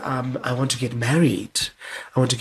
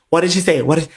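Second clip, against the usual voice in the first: louder, higher, impatient asking; mock eager questioning; 5 semitones higher, 6 dB louder, faster than usual. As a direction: press for news impatiently. Imitating friend's eager questioning.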